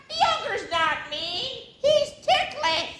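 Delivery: very high giggling ogre voice; high laughing creature; ticklish giggle. Acted.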